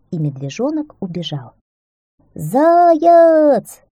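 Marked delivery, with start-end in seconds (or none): 2.4-3.8 high, drawn-out call through the woods; loud sustained summoning shout; calling out loudly. A narrator's reading. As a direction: holler for someone far off, stretching the vowels. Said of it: "закричал ёжик".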